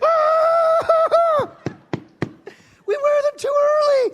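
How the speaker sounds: very high, loud shriek; shrieking shout. Retold or imitated. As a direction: scream it with glee. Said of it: "Jerry's like".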